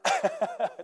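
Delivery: high burst of laughter; helpless high chuckle; real laugh. Real, not acted. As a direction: break up laughing. Preacher cracks up after his outburst.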